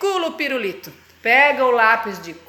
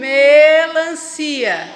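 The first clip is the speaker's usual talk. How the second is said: melancia stretched very slow, each syllable drawn out and louder; 4 dB louder, much slower than usual. Shown as a demonstration. Teacher sounding out slowly for the child. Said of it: "vamos falar melancia devagarinho".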